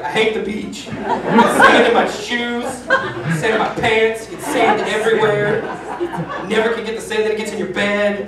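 laughing through a hammed-up whiny complaint; mock grumpy rant. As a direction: whine about the sand, half laughing. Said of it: "And I was like".

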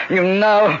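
high, strained cry of alarm; startled recoil. Acted. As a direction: recoil in sudden fear. Count recoils from the holy book.

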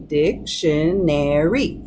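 "dictionary" drawn out slowly, in four separate syllables. Shown as a demonstration. Teacher counting out the syllables for learners, slow and separated. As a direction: break "dictionary" slowly into dic-tion-ar-y, each part distinct. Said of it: "four syllables".

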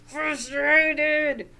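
loud, high mock-frustrated burst; acted frustration. Teacher on girl who can't zip her coat, hammed-up frustration.

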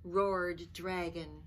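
put-on expressive read-aloud for children; lively picture-book reading voice; storybook narration. A narrator's reading. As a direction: tell it like a bedtime story.

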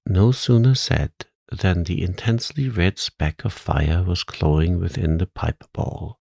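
plain reading voice

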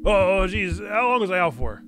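put-on Gabagool accent. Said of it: "Bo's got a strong Gabagool accent".

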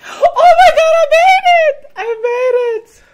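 shrieking, laughing with relief; high joyful scream of relief; relieved laughter. Real, not acted. Finally makes the hard jump.

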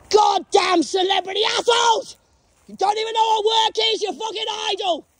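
yelling abuse at celebrities; furious shout. Real, not acted. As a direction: scream the insult at them. Ranting at celebrities.

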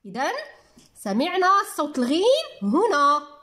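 ordinary delivery

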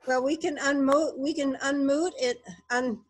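talking through cotton in mouth. Real, not acted. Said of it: "I've got cotton in my mouth".

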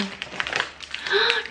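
sharp high breathy gasp; high delighted gasp. Real, not acted. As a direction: gasp in delight at something cute. Seeing cute packaging.

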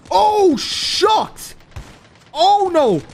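high, loud startled cry; startled outcry. Real, not acted. Streamer reacting as the game goes wrong, startled outcry. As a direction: cry out in real alarm, pitch shooting up.

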